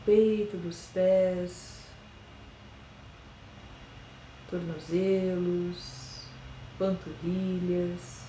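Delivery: slow, soft meditation voice with long pauses; guided meditation. Acted. Yoga teacher guiding listeners through a body scan, slow and soft meditation voice. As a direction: ease them into calm, one body part at a time.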